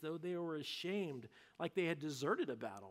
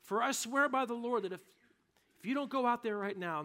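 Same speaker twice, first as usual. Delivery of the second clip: higher, louder sworn threat; stern warning; 6 semitones higher, 4 dB louder than usual. Retold or imitated. Joab warning the king to go out, loud stern oath. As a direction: lay down the ultimatum. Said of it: "God's word doesn't say all that".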